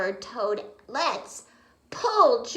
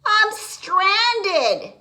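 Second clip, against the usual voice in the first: calling out; 10 semitones higher, 9 dB louder than usual. Acted. Frog calling out.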